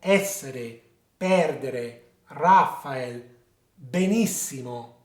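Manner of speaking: essere, perdere, Raphael, benissimo stressed ES, PER, RA, NIS; third-last-syllable stress. Shown as a demonstration. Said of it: "emphasis is on the third last syllable".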